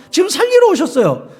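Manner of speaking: ordinary talk, nothing marked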